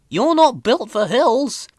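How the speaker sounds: a driver worried about the hill; a hint of concern; lightly voiced driver. A narrator's reading. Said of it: "said Thomas's driver".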